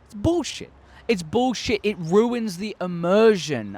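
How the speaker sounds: real frustrated cry of bullshit; exasperated outburst. Real, not acted. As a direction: throw up your hands at bad writing. Ranting about bad writing.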